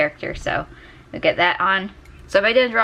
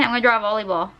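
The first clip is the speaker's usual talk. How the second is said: normal talking voice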